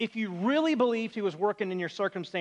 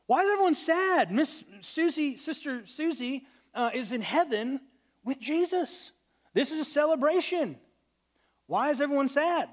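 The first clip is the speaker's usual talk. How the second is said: cheery eulogy preacher; 7 semitones higher than usual. Retold or imitated. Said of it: "and he said this".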